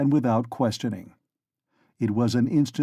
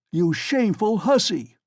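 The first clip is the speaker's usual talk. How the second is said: sudden furious shout; 6 semitones higher than usual. A narrator's reading. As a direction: bellow it at her. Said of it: "he shouted suddenly at the top of his voice".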